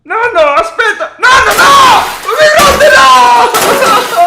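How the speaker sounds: high, loud desperate yelling; panicked screaming. Real, not acted. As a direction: scream to stop the wrecking. Grandson screams as grandpa smashes things.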